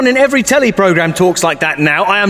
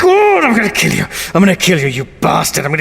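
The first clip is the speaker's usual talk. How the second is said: exasperated outburst; faster than usual. Acted. Fed up with the sass, hammed-up fury. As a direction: lose it comically. Driven mad by sass talk.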